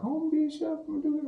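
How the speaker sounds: put-on high little-kid voice; put-on high voice of a child; imitated child. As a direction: pipe up like an eager young pupil. Quotes a child from his class.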